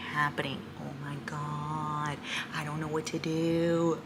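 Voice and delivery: very soft and low; softly at a loss; hushed, overwhelmed. Real, not acted. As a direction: trail into a stunned, quiet murmur. Overwhelmed as awaited moment arrives.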